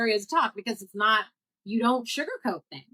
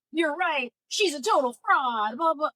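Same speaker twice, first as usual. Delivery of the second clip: mocking commenter voice; 8 semitones higher, 3 dB louder than usual. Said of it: "in the comments saying".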